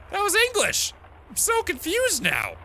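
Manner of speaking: very high, baffled whine; hammed-up confusion on a call; bewildered. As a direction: protest in a high, puzzled voice. Acting out confused composer on phone.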